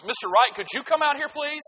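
high sing-song teacher summons; teacher calling. Retold or imitated. Teacher at the door calling a pupil out, lightly mock sweet. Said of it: "the teacher opens the door and goes".

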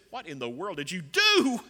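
acted-out indignant outburst; David, displeased. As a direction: demand an answer, appalled, hitting the end hard. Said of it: "he does not respond in a favorable manner".

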